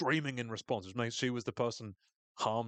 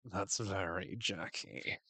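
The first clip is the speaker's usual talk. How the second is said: slow, softer, bone-dry contempt; imitated weary dislike, lower and quieter; deadpan disdain; 4 semitones lower, 4 dB quieter, slower than usual. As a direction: say it like an old familiar grudge. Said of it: "A really funny line read".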